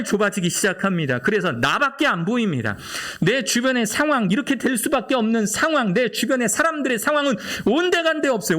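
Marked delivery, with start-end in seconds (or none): 3.2-8.6 much higher, urgent preacher's cry; soaring pulpit fervor; impassioned preaching. Acted. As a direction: lift the voice high and press the point home. Preaching rises to full force.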